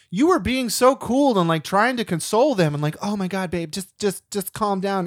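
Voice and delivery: high-pitched, animated marvelling; retold comic's astonished praise; amazed admiration. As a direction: gush in disbelief at someone's patience. Comedian marvels, mimics her calming friend.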